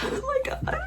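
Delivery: high, soft, giggly oh my god; giddy squeal. Real, not acted. Reader delighted by a scene, high giggly squeal. Reading an exciting part.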